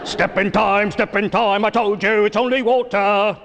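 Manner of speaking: put-on singsong Cockney chimney sweep accent. Comic doing a Cockney chimney sweep, light singsong. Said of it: "James Bond's chimney sweep".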